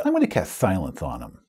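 interrupting player. Acted. Player interrupts the villain's monologue, low and deadpan. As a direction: drop the drama and announce an action.

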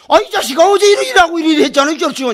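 angry yell. Retold or imitated. Gyeongsang man yells at the pestering Chungcheong man, loud mock anger. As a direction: bark it out like a hot-tempered Gyeongsang man.

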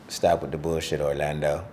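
hammed-up baby talking from the belly. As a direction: voice the unborn baby, low and hushed. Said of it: "That's what the baby said".

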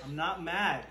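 ordinary delivery

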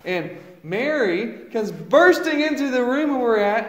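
normal talking voice

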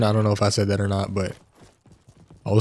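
ordinary delivery

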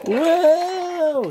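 very high joyful woo; whooping cheer. Acted. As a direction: cheer wildly as you zoom by. Fergus flies past cheering.

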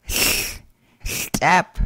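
"step." with a hissed slurpy lateral at the start; "step." begun with a voiceless lateral hiss; slurpy barred L. Shown as a demonstration. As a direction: blow air out past the tongue side on "step.". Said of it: "pronounced as a slurpy L".

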